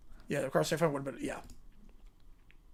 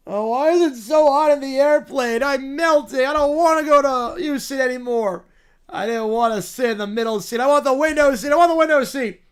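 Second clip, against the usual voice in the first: higher, louder bratty whine; whiny spoiled royal; 5 semitones higher, 6 dB louder than usual. Acted. Spoiled character complains about the flight, whining. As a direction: whine like a pampered little royal who hates travel.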